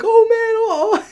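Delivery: high, alarmed squeals, fading; panicked yelp. Retold or imitated. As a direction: squeak in horror at what just happened. Realizing spikes flew off.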